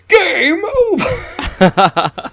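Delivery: high, slow, hammed-up villain gloat with a laugh; villain taunt. Mocking the movie villain's line, hammed-up and slow. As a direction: drag out the gloat like a cartoon villain. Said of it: "It just goes".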